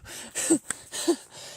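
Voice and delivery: quick unvoiced high laugh; high breathy laugh. Real, not acted. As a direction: laugh breathily at how it ended. Laughing after cop flees.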